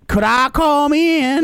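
catchphrase scream. Shown as a demonstration. Host shows the guest how to scream the catchphrase, high and slow. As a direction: wail the catchphrase slowly. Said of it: "You have to scream".

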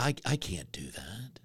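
breathy whisper, retelling a moment of self-doubt. Retold or imitated. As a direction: hush it out like an aside you barely believe. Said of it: "I'm like".

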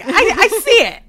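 high, loud knowing mom voice; a mom proud she spotted it. Retold or imitated. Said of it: "She's like".

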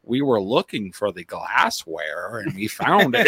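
a slow, light Southern drawl with a laugh; imitating a Japanese woman's Southern accent, chuckling; slow, amused Southern accent impression. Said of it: "she has a Southern accent now".

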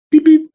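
high, loud imitated beeps like an auction clock; sharp mock electronic beep-beep; timer beeps. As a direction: chirp it like a countdown timer.

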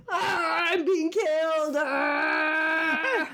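drawn-out mock cry of agony; half-strength wailing scream, hammed up; imitated screaming. Psychic face calling to the dad, mock scream. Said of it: "screaming at him".

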